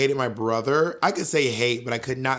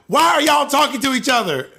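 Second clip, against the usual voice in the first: high, loud mock outrage; retold indignant shout; scolding mom; 11 semitones higher, 6 dB louder than usual. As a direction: imitate a mother yelling at her kids. Mom catches the children talking.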